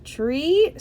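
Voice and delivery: high, rising hopeful question. A narrator's reading. George answers his mom's trick or treat, hopeful. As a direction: ask it hopefully, lifting at the end. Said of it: "said George hopefully".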